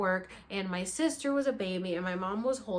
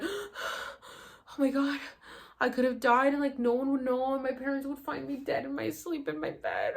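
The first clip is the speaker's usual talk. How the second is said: panicked; 5 semitones higher than usual. Retold or imitated. Panicked reenacted thought.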